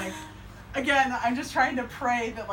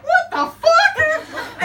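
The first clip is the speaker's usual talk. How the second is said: much higher, louder, very slow drawn-out cry, laughing through it; shocked American outburst; 10 dB louder, much slower than usual. Retold or imitated. Americans reacting to vomit on a train, drawn-out laughing shout. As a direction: blurt it out like an American who can't believe the mess. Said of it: "people would be like".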